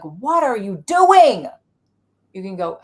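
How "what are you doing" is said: annoyed part. Acted.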